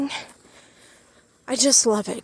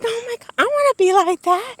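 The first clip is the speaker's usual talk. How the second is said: high, loud excited squeal; excited gushing; 13 semitones higher, 5 dB louder than usual. Retold or imitated. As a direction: gush like a starstruck fan. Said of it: "I was like".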